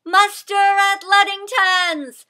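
high, slow, drawn-out alarm call; rallying shout. A narrator's reading. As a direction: shout the call to arms at a closed door. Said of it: "she shouted again and again".

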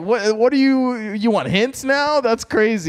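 high, loud mock disbelief; incredulous retelling. Host retelling his reply to the guesser, incredulous.

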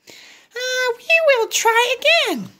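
high little pig voice; Piggy encouraging Gerald, high voice. Acted. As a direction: pitch way up, cheery little pig. Piggy's line.

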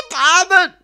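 high, loud cry for mercy; groaning in pain. A narrator's reading. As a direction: groan it out after a painful blow. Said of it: "ächzte das bedauernswerte Opfer". (schmerzvoll geächztes Flehen)

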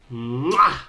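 loud, smacking mwah; blown kiss. Real, not acted. Vlogger signing off to viewers, exaggerated kiss sound. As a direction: hum into a big kiss smack.

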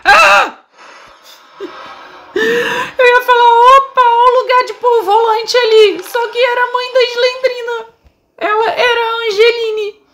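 high shaken laugh-cry; shriek, then laugh it off; scared scream. Real, not acted. Caught by monster, screams.

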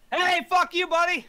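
high, loud jeering shout; taunting yell. Real, not acted. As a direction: jeer at him loudly. Taunting enemy, yelling.

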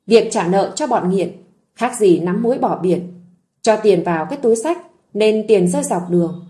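plain reading voice

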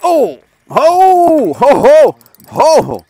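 loud, high hooting whoops; excited whoops. Real, not acted. Fisherman whooping as a fish hits the line, loud and high.

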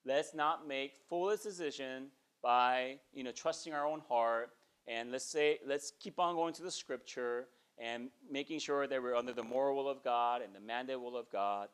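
ordinary talk

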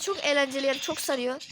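ordinary delivery

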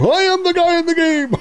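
high, loud announcer voice claiming the line; hammed-up arcade announcer declaring who he is; announcer's proud reply. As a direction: boom it out like the game's announcer. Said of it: "He's like".

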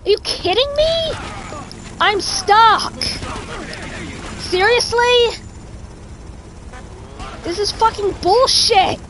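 high-pitched, incredulous angry yelling; real exasperated outburst. Real, not acted. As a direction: explode in frustrated disbelief at a game. Stuck in game, exasperated outburst.

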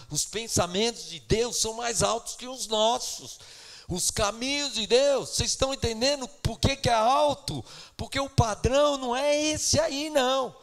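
fiery preaching. Acted. Preaching at full force.

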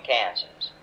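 normal talking voice

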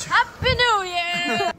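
excited cheer. Real, not acted. New Year countdown celebration cheer.

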